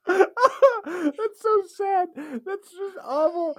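high, slow words through laughter; crying with laughter; laughing. Real, not acted.

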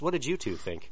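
ordinary talk

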